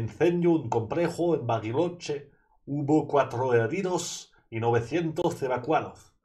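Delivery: reading Spanish words with a Spanish accent. Shown as a demonstration. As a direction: put on the Spanish accent for the headline. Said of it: "Je pense que c'est l'accent espagnol".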